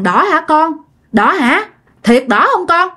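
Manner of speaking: acted shock, voice lifted; startled disbelief. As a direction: imitate a stunned mother asking to be sure. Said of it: "bà ngoại cũng hết hồn".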